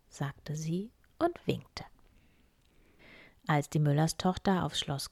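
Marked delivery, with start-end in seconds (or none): none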